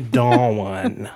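Dawn stretched into two syllables, Southern drawl. Shown as a demonstration. Host jokes the name needs two syllables, Southern Dawn. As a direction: say Dawn as Daw-un. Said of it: "gotta be two syllables".

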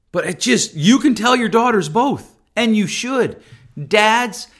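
ordinary talk, nothing marked

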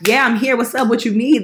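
eager, loud and keen; excited. Retold or imitated. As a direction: jump at the chance. Her eager reply to the artist.

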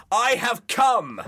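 high, slow, shrieked scream; an angry teacher screaming at the class. Retold or imitated. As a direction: shriek it out, drawn right out. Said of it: "I just screamed at them".

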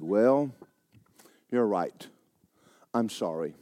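meek and calm; quiet apology. Retold or imitated.

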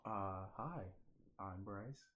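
mumbled, very quiet; too quiet. Real, not acted. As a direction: barely say it. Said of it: "That's way too quiet".

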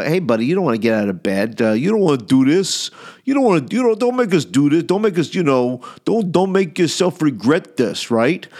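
hammed-up mob heavy leaning on a victim; mock menace, stumbling over the threats; threatening mafia guys. Mafia excuses warn him to stay in bed, mock threatening. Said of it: "they were, like, threatening me".

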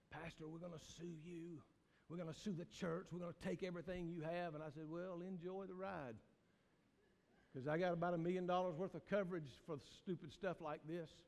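hushed, wry retelling of the lawsuit threat; quiet retelling. Retold or imitated. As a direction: tell it low, almost confiding. Retold lawsuit threat and reply.

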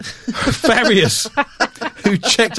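put-on high laughing Argentinian accent. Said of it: "I'm trying my best Argentinian here".

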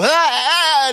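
high, loud wailing yell; mock crazed scream. Man wanting to take off running, mock crazed scream. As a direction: scream like you're about to bolt. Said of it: "I should just go".

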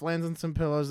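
usual speaking voice

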